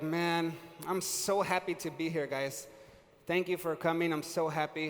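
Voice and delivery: flat, quiet words of joy with no joy in them; emotionless happy. Retold or imitated. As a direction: say you are happy without showing it. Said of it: "There's not a lot of emotion being expressed".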